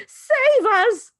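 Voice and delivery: very high, loud scream; wife throttling husband, screaming. Acted. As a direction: scream for rescue. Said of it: "the mother screamed".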